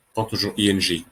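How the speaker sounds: ordinary talk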